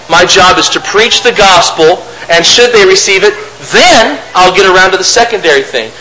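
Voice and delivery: normal talking voice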